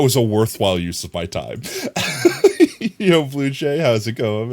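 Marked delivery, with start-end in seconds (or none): 1.7-2.9 high-pitched giggle burst; squeaky laugh. Real, not acted.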